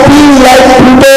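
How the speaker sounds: each word drawn out slowly; slow motto. Shown as a demonstration. Telling the students his motto, slow and deliberate. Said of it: "मैं बोलता हूं".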